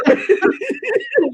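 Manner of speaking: high helpless laugh; real squealing laughter. Real, not acted. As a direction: crack up at the joke. Cracks up at the joke.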